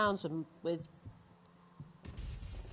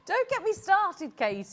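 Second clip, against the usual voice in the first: mock exasperation; 8 semitones higher, 5 dB louder than usual. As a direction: protest theatrically, stretching it out. Mock protest at teasing about moaning.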